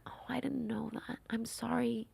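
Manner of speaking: mom's apology, whispered softly; retold whisper. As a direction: hush it like a mother's gentle apology. Mom apologizing tenderly, retold.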